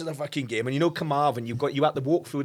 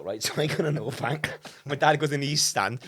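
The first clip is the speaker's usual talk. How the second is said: normal talking voice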